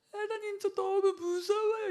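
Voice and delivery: weeping prayer, slowed and high; crying prayer. Retold or imitated. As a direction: cry it out to God, voice breaking high. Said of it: "이러면서 막 울었어요".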